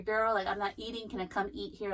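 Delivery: ordinary delivery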